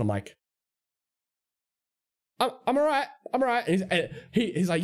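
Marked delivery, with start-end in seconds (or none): 2.4-3.7 high, uneasy polite refusal; nervous refusal. Retold or imitated. Clerk to an approaching customer who says come listen, nervous. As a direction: wave him off in a raised, nervous voice. Nervously declining the man's invitation.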